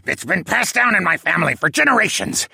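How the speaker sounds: cackle, then high, loud squawky boast; gloating penguin villain. A narrator's reading.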